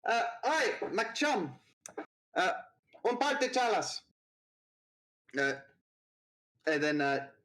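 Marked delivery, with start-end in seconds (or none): 0.0-4.0 high-pitched call across the bar; calling to bartender. Acted. Trinidad orders drinks from the bartender, high and calling. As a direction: shout the order over to the bar. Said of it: "she shouts over to the bartender".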